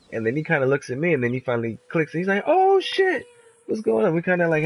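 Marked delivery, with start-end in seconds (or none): 2.4-4.1 much higher, startled oh shit; sudden surprise at seeing an old friend; surprised recognition. Retold or imitated. Eric suddenly recognises his friend and yelps in surprise.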